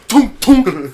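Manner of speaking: high, loud "tonk" knocks; two punchy pecking noises; imitated double peck. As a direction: hammer out a beak strike. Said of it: "and then goes".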